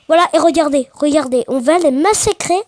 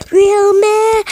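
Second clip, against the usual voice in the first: voix de dessin animé; 6 semitones higher, 4 dB louder than usual. Retold or imitated. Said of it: "ça fait".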